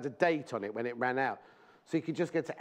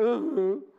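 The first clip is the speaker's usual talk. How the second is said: short laugh; 7 semitones higher, 6 dB louder than usual. Real, not acted. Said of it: "That's the noise [name] just made".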